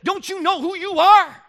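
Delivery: preaching climax refrain; fervent refrain. Real, not acted. Refrain to teenager.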